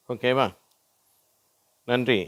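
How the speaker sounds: usual speaking voice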